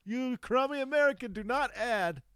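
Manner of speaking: high-pitched indignant telling-off; mock scolding. Imagined Finns tell off an American over coffee, mock scolding.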